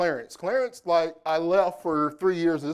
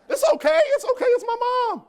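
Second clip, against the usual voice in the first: very high, squeaky mock-soothing voice; mock high reassurance; 7 dB louder than usual. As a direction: squeal it like a joking falsetto. Teasing reply to the crowd.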